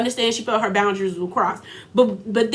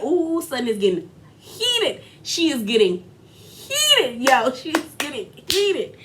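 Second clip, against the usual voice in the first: gleeful instigating. Retold or imitated. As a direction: relish the drama, stretching the key word. Gleeful reaction to a rising quarrel.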